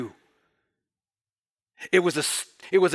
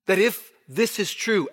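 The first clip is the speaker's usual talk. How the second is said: ordinary delivery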